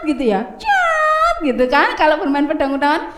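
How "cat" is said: sword sound. Shown as a demonstration.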